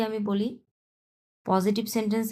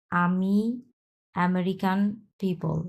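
usual speaking voice

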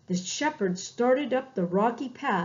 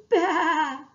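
lamb's bleat; 6 semitones higher, 6 dB louder than usual. Acted. Lamb shivering in cold.

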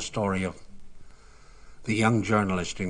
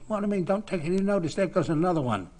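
much higher, exasperated outburst; indignant retort; 11 semitones higher, 5 dB louder, faster than usual. Retold or imitated. Charlie answering teammates after being hit, indignant. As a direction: snap back at the useless advice.

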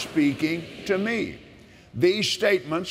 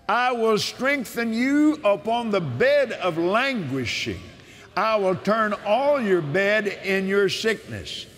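preaching at full force; 5 semitones higher than usual. Acted. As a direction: shout the promise like from the pulpit.

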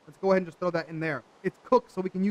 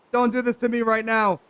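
louder, higher plea in real frustration; exasperated protest; 4 semitones higher, 6 dB louder than usual. Real, not acted. Player begging the glitched game to behave, exasperated. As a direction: raise your voice at the game. Pleading with the broken game, escalating.

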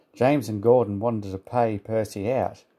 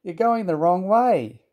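high, loud cry of alarm; storyteller's urgent shout; calling out; 8 semitones higher, 5 dB louder, slower than usual. A narrator's reading. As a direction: yell a sharp warning. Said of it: "called his driver".

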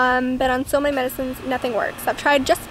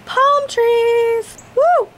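high, loud thrilled cheer; excited; 8 semitones higher, 5 dB louder, much slower than usual. Real, not acted. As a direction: squeal at the palm trees. Excited at beach view.

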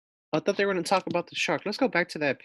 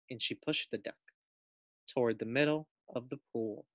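ordinary delivery

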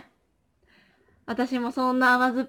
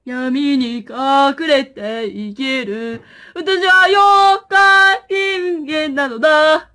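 Yokai Ningen voice; 2 semitones higher, 10 dB louder, much slower than usual. Pretend. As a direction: drag it out like a spooky TV narrator.

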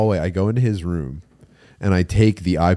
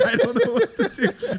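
laughing. Real, not acted. Quoting the hotel worker, laughing.

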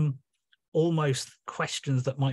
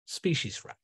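species wreck repeated softer, falling; 5 dB quieter than usual. Acted. Neptune echoing the ruin, soft species wreck. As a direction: let species wreck sink quietly. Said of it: "in the voice of Neptune".

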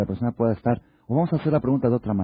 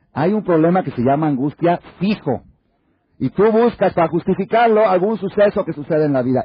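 loud, high, forceful preaching; full-force preaching; 8 semitones higher, 6 dB louder than usual. Acted. Rabbi describing the man searching for a cause, preaching hard. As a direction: drive the point home hard.

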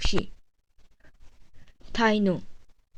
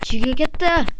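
anime character voice; 6 semitones higher than usual. Acted. Voicing character mid-battle.

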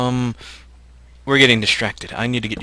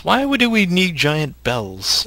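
nerd voice; 7 semitones higher, 4 dB louder, slower than usual. Acted. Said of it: "It's my nerd voice".

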